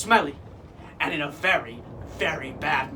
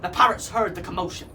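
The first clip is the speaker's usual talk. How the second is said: ordinary delivery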